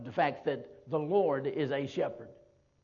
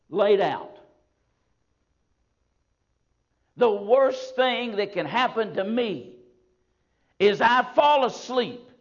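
loud, slow, higher preaching; rising sermon build; 7 semitones higher, 8 dB louder, slower than usual. Acted. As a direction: build the worst case slowly.

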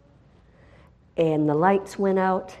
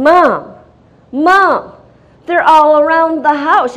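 higher and louder, panicked calls for mom; frantic son; 9 semitones higher, 11 dB louder, slower than usual. Retold or imitated. Son running in to warn his mother, frantic loud alarm. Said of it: "my son is going".

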